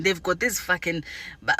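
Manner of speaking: usual speaking voice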